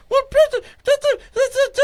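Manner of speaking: shrill nagging repeat; squeaky urging. Retold or imitated. Pushing friend to press record, squeaky high and urgent.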